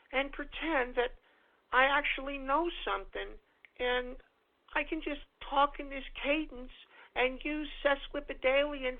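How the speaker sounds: hushed, mock-precious imitation of a pompous intellectual; mock Jordan Peterson. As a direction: sneer it out as a self-satisfied know-it-all professor. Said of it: "I'd like to hear his little pompous ass".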